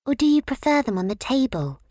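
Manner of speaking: put-on high, bright little flower girl; Violet's voice. A narrator's reading. Violet asking Fifi about the bowls, high tot voice. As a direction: pitch her up as a tiny flower tot.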